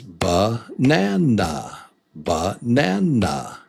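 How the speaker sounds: Banana slowly stretched over three beats; syllable by syllable. Shown as a demonstration. Teacher showing learners the beats, Banana slow and split. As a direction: say Banana with a gap after the first syllable. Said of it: "There it is".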